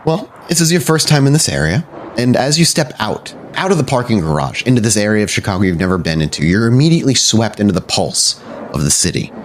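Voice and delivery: ordinary talk, nothing marked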